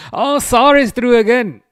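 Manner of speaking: sudden high, loud excited outburst. Real, not acted. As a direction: burst out in delight. Excited reaction to match news.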